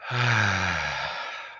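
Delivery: a long, audible relaxing sigh; deep sigh. Shown as a demonstration. Teacher leading trainees in a relaxing sigh, long and slow. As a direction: breathe out slowly and let it go. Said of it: "Everyone can take a deep sigh".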